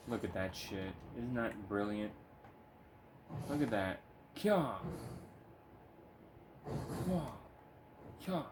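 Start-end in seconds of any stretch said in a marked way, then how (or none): 4.4-8.4 high, sharp mock martial arts yells; playful karate shouts; imitating kung fu kicks with cries.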